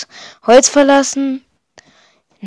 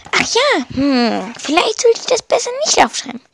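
high voice of a downtrodden noob; Minus the young villager; 6 semitones higher than usual. Acted. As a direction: read as a wishful little villager confiding in a diary.

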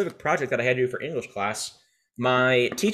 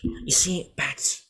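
Joker voice; 2 semitones higher than usual. Acted. Podcaster showing off a Joker voice to a friend, hammed-up Joker. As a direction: taunt like the Joker, halting between phrases. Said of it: "my Joker voice is better".

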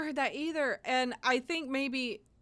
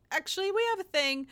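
quick on the spot excuse; 8 semitones higher, faster than usual. Retold or imitated. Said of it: "very on the spot".

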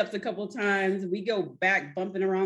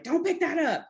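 imitated yelling; 10 semitones higher than usual. Friends telling her not to lift, mock yelling. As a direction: imitate friends scolding in a raised pitch. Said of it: "they were yelling at me".

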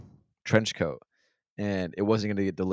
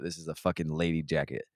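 ordinary talk, nothing marked

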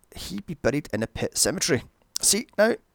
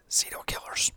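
whispered as a dark joke; conspiratorial whisper; 6 dB quieter than usual. Real, not acted. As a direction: lean in and whisper it.

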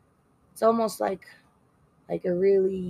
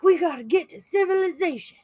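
high, loud mock panic; hammed-up alarm; scared, urgent; 9 semitones higher, 5 dB louder than usual. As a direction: voice frightened characters in a raised pitch. Now they're all scared.

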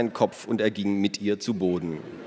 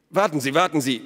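high, louder plea to stop; urgent call; 7 semitones higher, 5 dB louder, faster than usual. A narrator's reading. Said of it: "rief der Schmied". (flehend gerufen)

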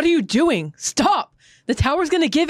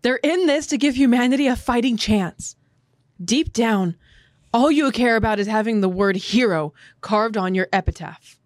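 pissed off; 13 semitones higher than usual. Acted. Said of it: "a pissed off Ymir tells Krista".

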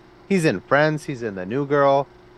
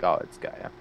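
whispered aside; 7 semitones lower, 8 dB quieter than usual. Retold or imitated. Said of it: "I was like".

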